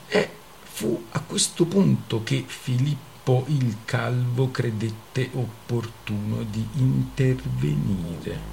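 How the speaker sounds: ordinary delivery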